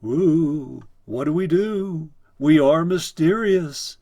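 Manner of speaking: loud, high, slow mock-ghost wail; fake spooky voice. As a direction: play a hammed-up spook, stretching every word. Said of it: "used a fake, spooky voice".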